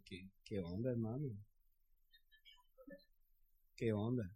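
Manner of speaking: doubting believer. Retold or imitated. Mocking doubters in the church.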